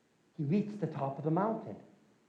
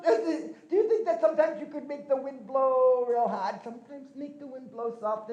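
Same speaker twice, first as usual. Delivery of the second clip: put-on higher, innocent trickster boy voice; young Glooskap; 6 semitones higher than usual. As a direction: play the cheeky boy feigning innocence. Glooskap asks eagle a favor.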